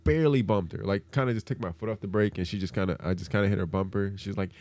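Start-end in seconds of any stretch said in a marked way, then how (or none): none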